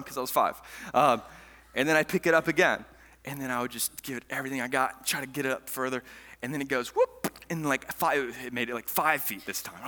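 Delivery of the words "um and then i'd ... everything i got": normal talking voice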